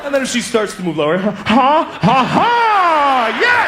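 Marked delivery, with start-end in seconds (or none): 1.2-3.7 excited shout. Pretend. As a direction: cheer like you just won. Delighted moan as she moves lower.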